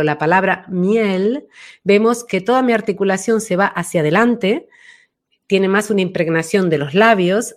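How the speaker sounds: ordinary delivery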